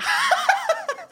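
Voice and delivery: real delighted shriek of a laugh; giddy high laugh; high squeal of laughter. Real, not acted. Laughs at the dentist's quote.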